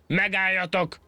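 very high-pitched strained cry; bellowed. A narrator's reading. Enraged monkey after the winners, bellowed. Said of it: "és elordította magát".

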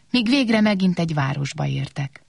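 ordinary delivery

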